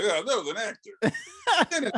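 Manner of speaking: high, slow, laughing mock voice; mocking quote. Fan mocking a lame resurrection excuse, high and laughing.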